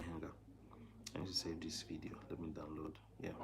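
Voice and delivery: very soft aside while saving the video; muttered aside. Real, not acted. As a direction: mumble to himself.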